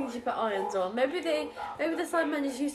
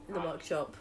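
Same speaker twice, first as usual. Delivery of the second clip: low, soft impression with the feeling drained out; emotionless man; 7 semitones lower, 5 dB quieter than usual. Co-host answers their own question as the character, imitating emotionless speech. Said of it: "like, really emotionless".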